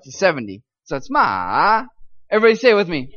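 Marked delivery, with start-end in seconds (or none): 1.1-1.8 slow "ma" dipping down then back up; third tone ma. Shown as a demonstration. Teacher demoing the dipping tone slowly to students. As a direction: let "ma" fall and rise again. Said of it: "start at seventy, go down to thirty, back up".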